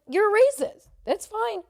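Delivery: high, loud call-out; retold loud accusation. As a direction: throw the accusation out at high pitch. Acted quarrel, repeated accusation.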